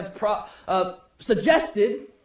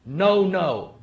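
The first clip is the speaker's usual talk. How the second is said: usual speaking voice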